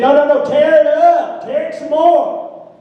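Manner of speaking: high, loud, drawn-out order; exasperated insistence. Retold or imitated. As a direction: push the pitch right up and insist.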